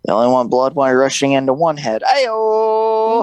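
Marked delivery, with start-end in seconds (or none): 2.0-3.2 high drawn-out whoop; teasing joke call-out. Real, not acted. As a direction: sing out a high, cheeky callout. Calls out after own dirty joke.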